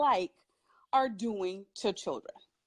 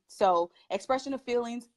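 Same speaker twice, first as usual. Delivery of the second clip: ordinary talk, nothing marked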